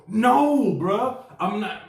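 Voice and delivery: high, loud drawn-out no; exasperated refusal. Retold or imitated. Reacting to the airport pickup, loud exasperated refusal. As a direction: refuse it with comic alarm.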